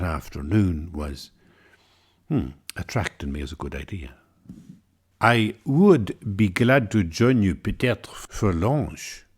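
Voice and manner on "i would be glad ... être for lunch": slow, hammed-up French accent; comic French accent. Offering to join two French women for lunch, comic French accent. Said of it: "in a comic French accent".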